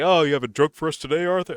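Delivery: lightly voiced guard ribbing the prisoner; mock friendly guard. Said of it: "He's like".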